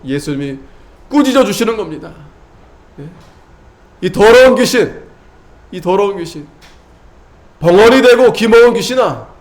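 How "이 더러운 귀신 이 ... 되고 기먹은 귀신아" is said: loud rebuke. Acted. Preacher rebuking spirit.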